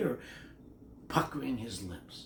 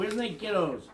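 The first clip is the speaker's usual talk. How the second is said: ordinary delivery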